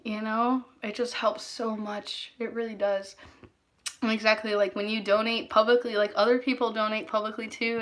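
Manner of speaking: normal talking voice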